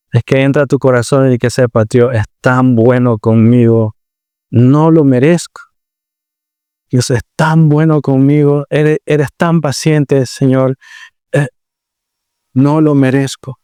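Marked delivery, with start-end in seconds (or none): 1.8-13.6 grateful prayer. Retold or imitated. Believer's grateful prayer voiced.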